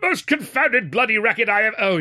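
loud high outraged shout; angry bellow. A narrator's reading. Irate student bellows at the clog dancer, outraged. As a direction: bellow your disgust. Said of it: "greeted with a bellow of".

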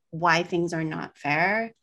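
ordinary talk, nothing marked

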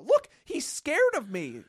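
high, loud, slow taunt; calling to everyone. Retold or imitated. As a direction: mimic her announcing it to the room. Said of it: "she kept saying to everyone".

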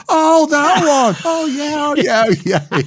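very high, loud, slow sheepish "oh yeah"; Harry caught out. Retold or imitated. Harry realizing the reporters can see the player, hammed-up sheepish realization. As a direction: caught red-handed, stretch it out.

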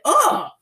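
sharp high disgusted ugh, loud; a loud grossed-out groan. Retold or imitated. Reacting to the movie with a loud disgusted ugh. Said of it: "I was like".

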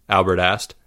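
ordinary delivery

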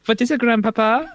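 a light mock German grandpa; German grandpa impression. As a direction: quote the old German from the film, lightly. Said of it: "one of the Germans from Beerfest".

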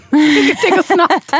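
laughed through, slow and loud; half-laughing, dragging the words out. Real, not acted. Laughing at the child joke.

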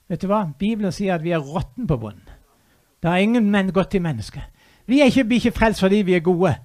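ordinary talk, nothing marked

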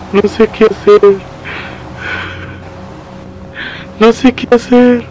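high, loud wail of helplessness, climbing on the repeat; desperate crying. Acted. Father telling his family he is lost, crying in despair. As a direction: sob it out, at a complete loss.

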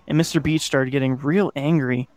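plain reading voice

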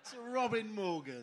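loud, high solemn proclamation; pompous will reading. Acted. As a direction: finish the grand bequest with ceremony.

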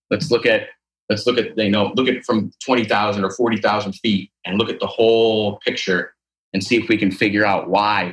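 ordinary delivery

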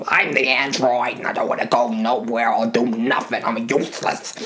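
a mild odd android voice; lightly voiced android. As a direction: grumble like a lazy android. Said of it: "why does he sound like this?".

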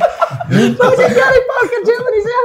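high laughing question, slow; asking while cracking up; giggling through the question. Real, not acted. Laughing at story.

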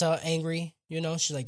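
normal talking voice

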